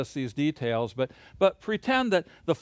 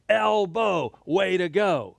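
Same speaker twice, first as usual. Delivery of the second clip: cheery elbow cheer; 2 semitones higher, slower than usual. Shown as a demonstration. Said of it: "I want you to say this".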